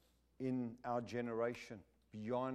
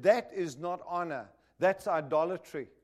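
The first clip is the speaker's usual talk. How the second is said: firm rebuke; 3 semitones higher, 8 dB louder than usual. Retold or imitated. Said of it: "I said".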